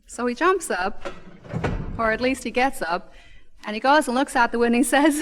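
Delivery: usual speaking voice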